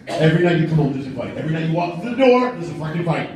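ordinary talk, nothing marked

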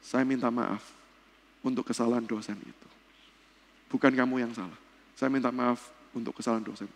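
a hint of a kind elderly rector; gentle apology. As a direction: rector reassuring a student, quiet and gentle. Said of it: "Pak Daniel Lukas berkata begini".

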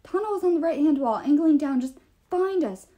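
high-pitched urgent alert; urgent messenger. Acted.

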